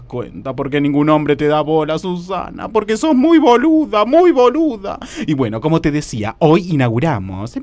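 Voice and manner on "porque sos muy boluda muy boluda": agudo, recalcando el insulto; retá a tu amiga con tono cantado; reproche burlón. Acted. Reta a su amiga por teléfono. (reproche exagerado y agudo)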